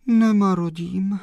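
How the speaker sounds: high, plaintive sighed reply; sighing. A narrator's reading. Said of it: "zavzdychla slečna Mařenka". (vzdychavě, vysokým hlasem)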